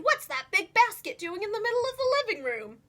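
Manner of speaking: a hint of a puzzled uncle; lightly voiced uncle, wondering; uncle's voice. A narrator's reading. Uncle Vernon asks Desmond about the basket, lightly voiced puzzled.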